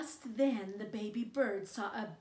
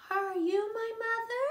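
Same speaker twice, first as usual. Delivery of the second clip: much higher, hopeful chick voice; baby bird asking; 13 semitones higher, 9 dB louder than usual. Acted. Baby bird asking a kitten if it's his mother, high hopeful chick voice. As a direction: ask like a tiny lost chick. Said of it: "the baby bird asked a cow".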